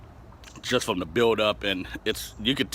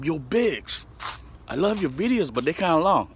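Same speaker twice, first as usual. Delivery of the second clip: lightly voiced mom, higher and sweet; a hint of his mom, pitched up; imitating his mom; 7 semitones higher than usual. Son quoting his mom's video critique, lightly higher voice. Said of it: "she was like".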